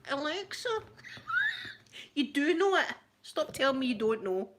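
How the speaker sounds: high laughing protest; laughing exasperation. Retold or imitated. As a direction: plead with the device, laughing. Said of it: "You're like".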